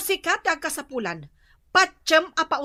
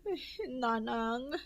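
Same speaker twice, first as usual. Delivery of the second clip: weeping; 6 semitones lower, 13 dB quieter, much slower than usual. Acted. Daughter weeps to mother.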